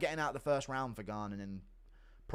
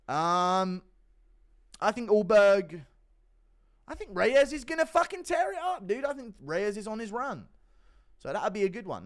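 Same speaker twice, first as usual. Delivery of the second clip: mock excited answer; 9 semitones higher, 7 dB louder than usual. Answering in a raised voice.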